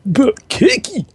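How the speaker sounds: very high Sunderland horse voice; Sunderland accent. Retold or imitated. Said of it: "the only two things I can do in a Sunderland accent".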